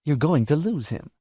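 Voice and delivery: sly goading; hissed. Acted. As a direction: hiss the warning. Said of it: "Jive hissed".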